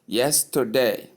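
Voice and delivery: "Yesterday" in three clear syllables, stressed on YES; model pronunciation. Shown as a demonstration. Teacher checking that learners got it, model pronunciation. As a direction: say Yesterday slowly as YES-ter-day, r-coloured middle. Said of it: "Do you get that?".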